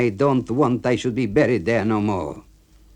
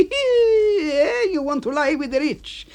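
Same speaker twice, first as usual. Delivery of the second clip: much higher, louder angry accusing; temper lost; 4 dB louder than usual. Acted. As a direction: rant at the snob. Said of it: "he's losing his temper".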